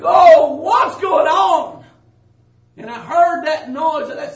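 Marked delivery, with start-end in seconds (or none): 0.0-1.9 loud, slow, high-pitched wail; distressed cry. Retold or imitated. Dreamer stopped in the dark again, loud bewildered cry. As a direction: call out into the dark, drawn out.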